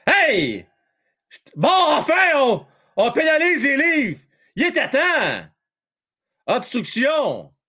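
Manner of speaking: high-pitched outburst at a penalty; indignant excitement. Real, not acted. Fan commentator greeting a penalty on the Leafs, excited. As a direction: exclaim that justice is done at last.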